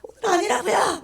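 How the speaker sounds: very high, wailing protest; mock sobbing refusal; imitated bawling. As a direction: whine it out as if bawling. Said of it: "제가 막 엉엉 울면서".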